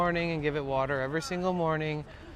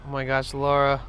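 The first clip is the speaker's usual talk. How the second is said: usual speaking voice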